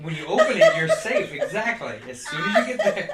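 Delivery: high, loud laughing talk; giggling out each phrase. Real, not acted. Riffing on the joke.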